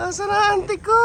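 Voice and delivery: calling out. Real, not acted. Calling out for his auntie.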